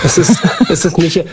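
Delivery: laughing while talking. Real, not acted. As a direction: push through the giggles. Caller laughing, then mentions the host laughing. (lachend, schnell und höher)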